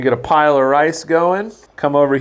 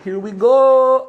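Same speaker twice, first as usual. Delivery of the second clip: drawn-out excited high call; 11 semitones higher, 5 dB louder, slower than usual. Real, not acted. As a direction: stretch it out, thrilled and loud. Excited build-up before the reveal.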